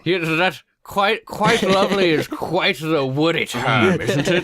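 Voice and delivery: put-on high, slow posh British accent; prim Monty Python voice. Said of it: "Monty Python references".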